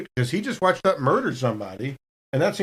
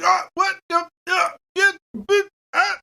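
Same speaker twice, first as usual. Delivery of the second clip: high, frantic gasped words; a dying man who can't form words; panicked babbling; 14 semitones higher than usual. Acted. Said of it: "He's like".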